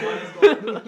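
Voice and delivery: loud laughing disbelief; excited. Retold or imitated. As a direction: shout it out laughing. Retold shocked reaction, laughing.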